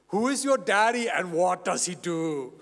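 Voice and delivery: put-on loud Austrian action-hero accent; Arnold Schwarzenegger. Detective questioning a kinder kid, Austrian accent impression. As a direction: bark it out in a thick Austrian accent. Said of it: "actor Arnold Schwarzenegger".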